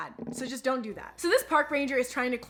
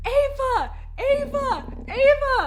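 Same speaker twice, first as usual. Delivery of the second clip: high, loud shouted calls of a name; calling out; 13 semitones higher, 6 dB louder, slower than usual. Retold or imitated. Friends calling for her down the slope. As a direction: call out across the mountain.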